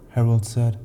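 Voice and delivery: ordinary delivery, nothing marked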